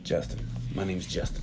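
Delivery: muttered correction. Retold or imitated. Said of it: "he corrects her".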